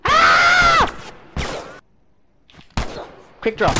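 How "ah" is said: very high, loud scream; startled scream. Real, not acted. Player startled in combat, high loud scream. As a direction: shriek in sudden alarm.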